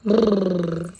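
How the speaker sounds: long, low brrr through the lips; playful lip-buzz for a baby; lip trill. Shown as a demonstration. Mother showing her baby how to go "boo", playful lip trill. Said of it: "ಹೆಂಗೋ ಬೂ ಮಾಡೋದು?".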